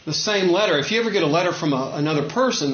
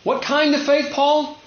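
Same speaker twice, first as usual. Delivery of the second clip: rhetorical question to Paul; 11 semitones higher than usual. Shown as a demonstration. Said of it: "The word faith is at the beginning".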